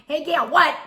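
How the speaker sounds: a high, loud laughing call of her name. Retold or imitated. Friend repeating the loud call to the neighbour. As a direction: shout it again, half laughing. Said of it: "You're just going".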